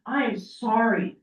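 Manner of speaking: ordinary talk, nothing marked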